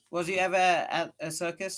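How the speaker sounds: excited question to a new friend; eager schoolboy. Acted. Tom asks Becky eagerly.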